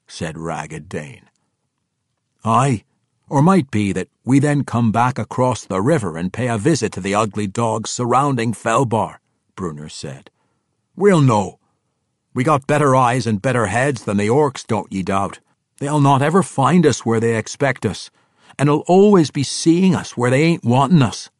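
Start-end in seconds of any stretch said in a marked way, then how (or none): 2.4-9.2 loud, higher dwarf warrior voice. Acted. Brunner voiced in story. 11.0-21.3 loud, higher dwarf warrior voice. Acted. Brunner voiced in story.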